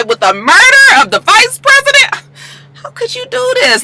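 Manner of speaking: high, loud outraged mock scolding; the president scolding Liv, voice up high; imitating pissed Melly. President scolding Olivia over the murder, high outraged mock scolding. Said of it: "Melly is pissed".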